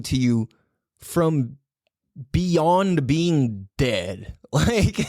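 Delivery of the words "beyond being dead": ordinary talk, nothing marked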